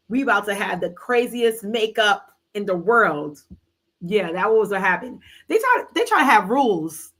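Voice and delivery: ordinary talk, nothing marked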